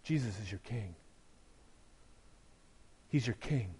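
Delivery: soft, low and unhurried; tender reassurance. Real, not acted. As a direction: reassure the hurting congregation gently.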